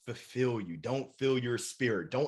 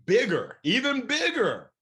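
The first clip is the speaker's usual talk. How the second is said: Bigger said louder, even bigger much higher; going bigger; 8 semitones higher, 5 dB louder than usual. Shown as a demonstration. Urging listeners to dream bigger: rising loud call. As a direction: push the word bigger up and up. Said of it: "No, no, no".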